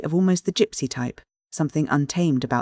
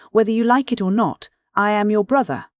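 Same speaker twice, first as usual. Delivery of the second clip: raised, louder and higher, pressing the point; forceful insistence; 6 semitones higher, 4 dB louder than usual. A narrator's reading. Passenger forcing brotherhood on the driver, loud insistent voice. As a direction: bear down on him like a bully.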